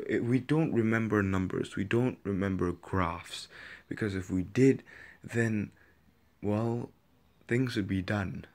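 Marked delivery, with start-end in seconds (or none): none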